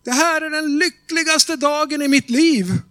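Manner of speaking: high, loud cry of happiness; shouted joy. Retold or imitated. Young woman shouting out her happiest day, high and loud. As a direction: burst out with joy. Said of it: "Den unga kvinnan skrek rakt ut".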